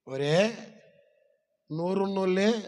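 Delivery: ordinary delivery, nothing marked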